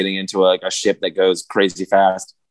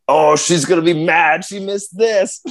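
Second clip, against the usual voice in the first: higher, slowing drawl of a tipsy guy; drunk buddy; 7 semitones higher, slower than usual. Retold or imitated. Retelling a drunk stranger at the movies, higher and slow. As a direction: play the drunk neighbour, sorry for his girlfriend. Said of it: "He's like".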